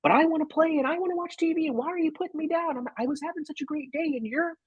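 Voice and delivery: put-on high, pleading child's whine; imitating a defiant little kid; whiny kid voice. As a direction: play the kid who won't go to bed. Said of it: "the kid is just like".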